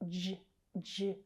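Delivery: "j, j" as short juh, juh; isolated J sound. Shown as a demonstration. Teacher isolating a consonant sound, "j, j" as juh. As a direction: voice "j" alone, twice. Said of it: "consonant sound".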